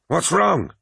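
sharp, high demanding cry. A narrator's reading. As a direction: demand an answer from the fallen lord's side. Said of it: "demanded Abaddon".

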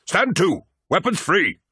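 high, urgent battle cry; rally the troops with a high shout; shouted command. A narrator's reading. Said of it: "shouted Torgadden".

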